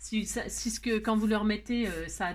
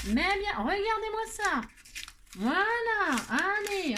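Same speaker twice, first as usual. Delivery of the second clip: exasperated outburst; 10 semitones higher, slower than usual. Real, not acted. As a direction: throw up your hands at the mess. Annoyed at messed-up page.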